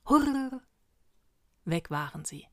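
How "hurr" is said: high, loud imitated whoosh; whooshing trilled hurr. A narrator's reading. Witches vanish after being dismissed, imitated rushing noise. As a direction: roll it out like a sudden rush.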